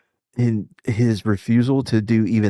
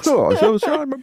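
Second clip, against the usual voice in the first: very high put-on officer voice; hammed-up officer giving orders; mock British officer. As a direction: play the British officer again in a silly high voice. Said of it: "don't know why I gave him a Southern accent".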